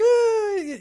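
put-on high, loud kid's "ah"; mock pain wail. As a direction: imitate a kid yelping over a cut. Kid cuts himself, cries out.

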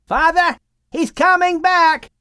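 put-on young son shouting the news; shouted. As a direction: call out to Father across the ship. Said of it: "Ham shouted".

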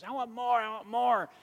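put-on high, loud whiny kid demand; imitating a screaming child. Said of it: "he starts screaming and yelling".

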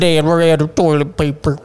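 put-on droopy dog voice; lightly voiced hound pleading to its owner; talking basset hound.

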